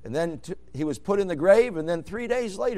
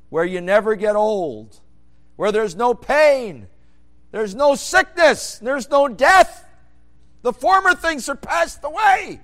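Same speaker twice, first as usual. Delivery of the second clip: higher, louder and slower, ending in a shout; building preaching; 8 semitones higher, 10 dB louder, slower than usual. Acted. Preacher describing heaven to congregation, building to a shout. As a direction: build toward heaven and shout the last line.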